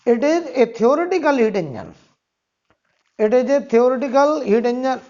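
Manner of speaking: slow, repeated dictation; dictating. Shown as a demonstration. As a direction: read each phrase out for copying. Dictating second point.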